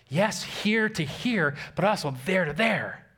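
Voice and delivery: high, driving pulpit delivery; emphatic preaching. Acted. Preacher tells church where the word is shared, emphatic preaching. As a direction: hammer the point home to the congregation. Preacher drives the point home.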